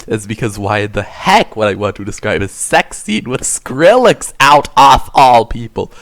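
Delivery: ordinary delivery, nothing marked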